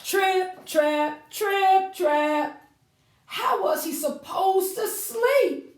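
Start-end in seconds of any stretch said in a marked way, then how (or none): 0.0-2.7 high, louder sing-song hoofbeats; hoof steps. Acted. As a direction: tap out the footsteps in rhythm. Goats' hooves on bridge.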